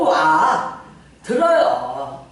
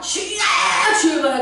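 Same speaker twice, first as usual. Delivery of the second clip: imitated prayer cry; 5 semitones higher than usual. Preacher imitates member praying on the hill, high cry. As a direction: cry it out like vocal practice. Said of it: "발성 연습하는 사람 있어".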